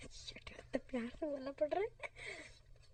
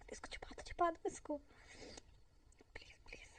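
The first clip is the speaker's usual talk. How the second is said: whisper; 4 semitones higher, 7 dB quieter than usual. Acted.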